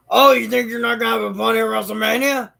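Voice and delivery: high, loud, fast promo voice; over-the-top ring announcement; hyped announcer. Retold or imitated. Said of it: "Vince goes".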